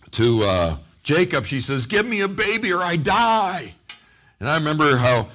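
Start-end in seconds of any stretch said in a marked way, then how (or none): 1.8-3.7 high-pitched retold plea, rising in force; acted desperation; desperate demand. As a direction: plead it urgently, as a matter of life. Acting out Rachel's desperate demand.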